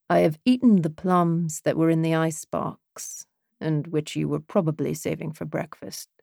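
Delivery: put-on understated British-accented recitation; poem recital. A narrator's reading. Man recites a poem to the woman at the bar, British-accented recital.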